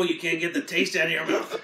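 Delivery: normal talking voice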